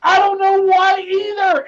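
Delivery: high, loud, slow outraged yell; shouting that everybody was blocked. Real, not acted. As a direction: bellow it like a man fed up. Ranting about blocked viewers.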